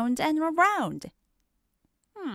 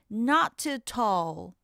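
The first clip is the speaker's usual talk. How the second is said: ordinary delivery, nothing marked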